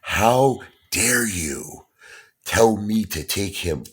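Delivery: slow, high, indignant imitated outrage; flipped out. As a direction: draw it out as an outraged father. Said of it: "he flipped out".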